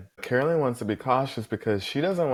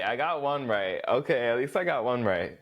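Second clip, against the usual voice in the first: ordinary talk, nothing marked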